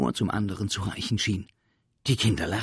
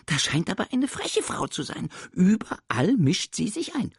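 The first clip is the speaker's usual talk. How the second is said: teasing creature; 14 semitones higher, 5 dB louder than usual. Acted. Sams teases teacher.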